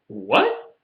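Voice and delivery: disbelief. Retold or imitated. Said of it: "I'm like".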